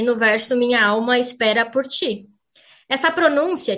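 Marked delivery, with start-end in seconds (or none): 0.6-2.2 quoted verse with "ti" said as "chee"; "alma" as "awma", "ti" as "chee"; Brazilian T pronunciation. Shown as a demonstration. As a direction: recite it the Brazilian way, "ti" ending on a ch sound. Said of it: "o T que vira ti".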